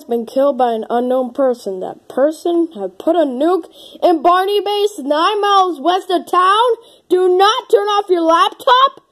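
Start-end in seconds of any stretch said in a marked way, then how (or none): 1.8-9.0 high, rising mock alarm; alarmed reading. Vlogger reading a nuke warning off the screen, alarmed. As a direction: read the warning out in mounting panic.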